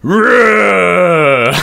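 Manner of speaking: loud, high grunting roar; a single brutish bark. Retold or imitated. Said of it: "this one just went".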